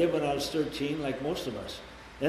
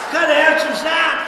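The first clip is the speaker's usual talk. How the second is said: laughing shout; 13 semitones higher, 12 dB louder than usual. Real, not acted. Laughing at his own joke.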